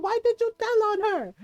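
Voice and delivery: high-pitched auntie reproach; retold exasperated scolding. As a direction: imitate an auntie scolding in disbelief. Tita scolds for telling.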